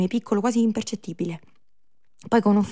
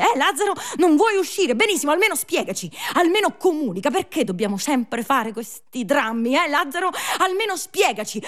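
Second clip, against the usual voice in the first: exasperated scolding; 6 semitones higher, 5 dB louder than usual. Acted.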